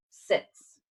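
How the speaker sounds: usual speaking voice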